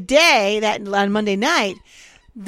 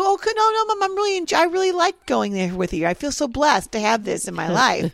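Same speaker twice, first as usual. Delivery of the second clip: high sugary reassurance; 5 semitones higher than usual. Retold or imitated. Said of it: "I go".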